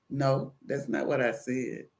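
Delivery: low, soft, strained patience; restrained correction. Retold or imitated. Caller correcting a phone agent, low and restrained. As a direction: correct them with held-back patience.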